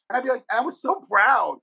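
ordinary talk, nothing marked

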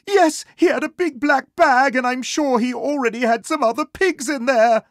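high, loud, frantic squeaky report; agitated high-pitched cartoon fright; panicked pig. Terrified pig describes the wolf.

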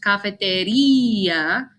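"cafeteria" with a long, stressed REE before the final a. Shown as a demonstration. Teacher shows students where the accent falls, stressed I. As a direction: lean on the i in "cafeteria": ka-fe-te-REEE-a. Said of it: "You stress the I".

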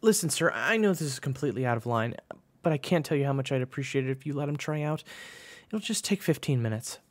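high, urgent plea; panicked pleading. A narrator's reading. June begs coach for a tryout, panicked pleading. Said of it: "June panicked and grabbed his arm".

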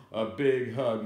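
ordinary delivery

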